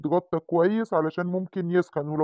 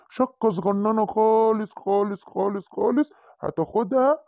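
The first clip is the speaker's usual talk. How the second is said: higher, slow coaxing; soothing; 6 semitones higher, slower than usual. Acted. Dog doctor coaxing the monkey about a tiny shot. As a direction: coax the scared child gently.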